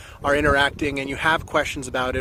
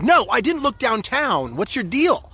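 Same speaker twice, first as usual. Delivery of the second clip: mild mock yell, slow and a bit higher; retold snappy yell; 5 semitones higher than usual. To his roommate who keeps nagging him about the job, a mild mock yell. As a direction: replay the irritated comeback to the roommate. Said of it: "Don't yell at me like I'm your dad".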